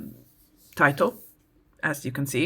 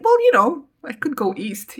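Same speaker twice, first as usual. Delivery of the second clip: scheming idea; 9 semitones higher, 6 dB louder than usual. Retold or imitated. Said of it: "And then she's like".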